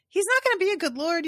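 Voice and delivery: high, quick retort with stress on "not"; dismissive retort. Acted. Aunt answering Jamie about her sons, high dismissive retort. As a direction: rattle it off high, rising at the end.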